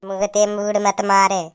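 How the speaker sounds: low, slow mimicry; mocking echo. Mother parroting father's telling-off, mocking echo. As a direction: drag it out low to mock him.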